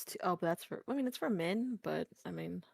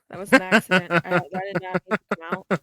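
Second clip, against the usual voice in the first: sudden loud sorry, startled; loud apology; 3 semitones lower, 13 dB louder than usual. Real, not acted. Apologizing to the group after an accidental noise, loud.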